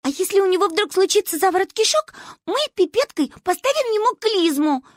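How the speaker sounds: put-on high, loud girl's voice; high-pitched childish zeal; carried-away child. A narrator's reading. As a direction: keep piling on ideas excitedly. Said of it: "Манюню несло".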